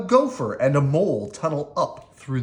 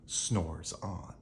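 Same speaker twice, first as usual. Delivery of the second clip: sleepy hush; 8 semitones lower, 12 dB quieter than usual. A narrator's reading. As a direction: lower and much softer, drifting off. Bear sleeping refrain.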